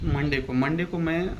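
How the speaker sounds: normal talking voice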